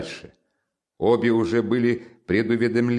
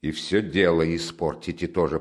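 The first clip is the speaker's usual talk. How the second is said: ordinary delivery, nothing marked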